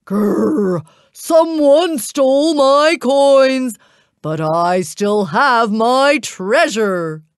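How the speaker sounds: slow, growling giant outburst; growl, then roar it out slowly; angry giant shouting. A narrator's reading. Giant finds his coins stolen, shouting angrily. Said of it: "the angry giant shouting".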